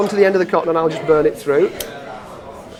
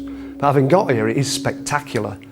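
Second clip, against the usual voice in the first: documentary narration; 6 semitones lower than usual. Acted.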